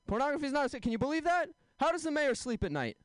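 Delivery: high-pitched mock indignation; mock outrage. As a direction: act scandalised, pitched well up. Mock outrage at the mayor in a bit.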